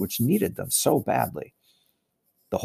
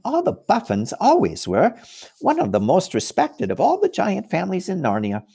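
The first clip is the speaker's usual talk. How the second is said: much higher, lilting fawn voice; fawn Tumnus; 3 semitones higher than usual. Acted. Tumnus tells Lucy about giant families, high and chatty.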